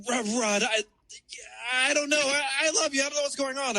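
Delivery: high, halting mock pleading; imitated flustered ex. Ex caught outside his complex, flustered high-pitched pleading. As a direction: stammer it high, mimicking a lovesick ex. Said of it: "And then she's like".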